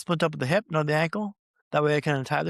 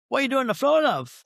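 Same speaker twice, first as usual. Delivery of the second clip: put-on much higher British accent, a cheery nurse; 9 semitones higher, 4 dB louder than usual. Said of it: "That's a fantastic British accent there".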